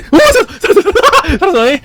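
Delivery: high laughing relief; relieved laughter. Real, not acted. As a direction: laugh it out, just survived.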